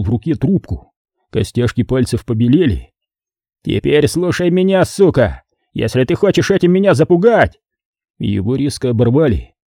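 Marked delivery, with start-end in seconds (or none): 3.6-7.6 raised-pitch, indignant comeback; angry retort. Acted. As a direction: push back at the caller with raised pitch. Boss snaps back at threatening caller. (сердито, повысив голос)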